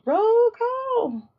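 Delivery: high, held singsong; high drawn-out announcement; singsong call-out. Real, not acted. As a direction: sing out the roll call softly up high. Calls out roll call to chat.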